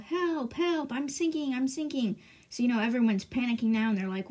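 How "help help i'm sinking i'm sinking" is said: higher-pitched retold yell for help; mock cry for help. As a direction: play a sinking king calling out. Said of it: "he's just yelling out".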